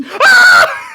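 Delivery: high, loud startled shriek; startled scream. Acted. Maya shrieks at the rat being shot. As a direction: let out a sudden shriek. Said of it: "just goes".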